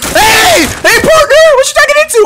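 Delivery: high, loud taunt; taunting yell. Real, not acted. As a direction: taunt the enemy loudly. Taunting enemies in game.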